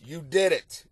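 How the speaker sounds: ordinary talk, nothing marked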